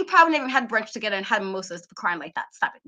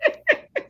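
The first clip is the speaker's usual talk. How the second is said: high, short laugh; real leftover giggles. Real, not acted. Cannot stop laughing.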